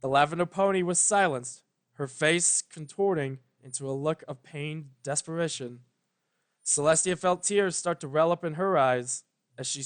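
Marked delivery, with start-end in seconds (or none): none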